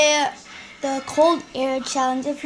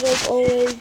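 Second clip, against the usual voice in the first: ordinary talk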